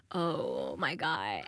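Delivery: very low, drawn-out disbelief; stunned reaction. Retold or imitated. Comic retelling her shocked discovery, stunned low groan of words. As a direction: drop the voice way down, softly aghast.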